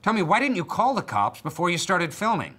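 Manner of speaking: high, loud confrontational demand; accusing question. Acted. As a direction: go on the attack as interviewer. Host confronts guest with accusation.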